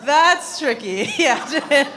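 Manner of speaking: slow, laughing through the words; sheepish laughing. Real, not acted. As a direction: giggle it out, caught off guard. Laughing at own oversight.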